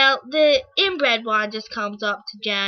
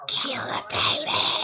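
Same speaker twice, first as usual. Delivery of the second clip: slow, creepy whisper; a slow menacing whisper; 6 dB quieter, slower than usual. Retold or imitated. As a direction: hiss it out slowly as a sinister order. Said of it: "the one in the wheelchair is like".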